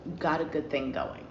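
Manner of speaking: soft repeat. Shown as a demonstration. Reader quietly repeating her advice to Cancer, soft and low. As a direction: murmur "You got a good thing going" as an afterthought. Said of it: "Don't ruin that shit".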